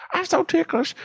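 high squealing tickled voice; being tickled. Acted. Voicing the tickled woman on video.

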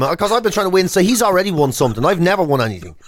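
normal talking voice

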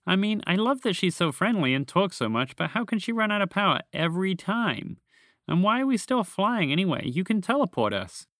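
high-pitched creature complaining; exasperated. A narrator's reading. Small green creature questions its companion, exasperated. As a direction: whine out your frustration.

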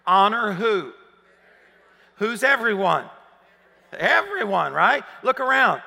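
loud rising preacher's call to the crowd; fervent pulpit delivery; full-force preaching. Acted. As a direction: drive it home to the whole room. Preaching to the congregation.

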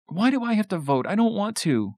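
put-on high-pitched small child's complaint; protesting little girl. A narrator's reading. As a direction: object like a reluctant five-year-old. Five-year-old Tina protests voting.

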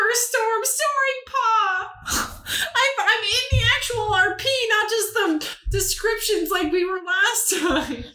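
very high, squeaky voice through laughter; helplessly cracking up. Real, not acted. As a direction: barely get it out for giggling. Cracking up at finding own character.

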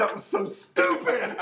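slow, laughing self-scolding. Retold or imitated. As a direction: drag it out, laughing at yourself. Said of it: "You're like".

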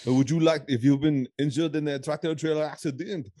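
hammed-up French attorney sales pitch; French Pierre. Podcast host playing an attorney selling lawsuits, comic French accent. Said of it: "an attorney called French Pierre".

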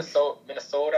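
long round Minnesota o in Minnesota, lifted to a question; a light try at the Minnesota accent on Minnesota. Retold or imitated. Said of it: "the Minnesota accent".

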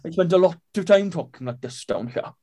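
put-on playful Welsh accent boast. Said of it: "I can do a better Welsh accent than that".

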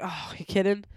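ordinary talk

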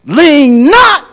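high, loud ringing cry; emphatic proclamation. Retold or imitated. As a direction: hammer home the warning. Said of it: "He said".

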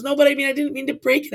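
mock teary excuse; whiny excuse. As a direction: imitate the tearful girl. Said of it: "She goes".

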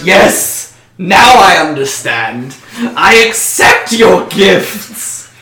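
loud, slow, exalted cry. Acted. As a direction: cry out in sudden revelation, drawing it out. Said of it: "Suddenly he cries out".

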